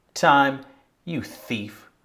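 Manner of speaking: accusing, finger-pointing poem delivery; disdain. Shown as a demonstration. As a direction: point the finger with disdain, pausing between phrases. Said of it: "I think disdain".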